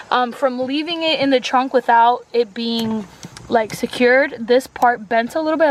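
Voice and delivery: usual speaking voice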